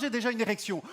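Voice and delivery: ordinary delivery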